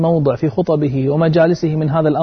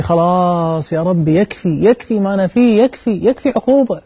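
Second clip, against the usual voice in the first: anguished plea; 6 semitones higher than usual. Retold or imitated. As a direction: beg God, rising from pleading to crying out. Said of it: "فقلت في نفسي". (مناجاة متألمة تتصاعد إلى صرخة)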